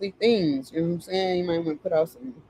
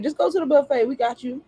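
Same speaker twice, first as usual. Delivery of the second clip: spirits; 7 semitones higher, faster than usual. Retold or imitated. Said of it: "They're like".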